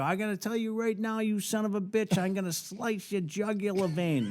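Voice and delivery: hammed-up threat, pitched high; threatening a supposed prankster, high mock menace. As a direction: play the tough guy, voice up high. Threatening the caller to call his bluff.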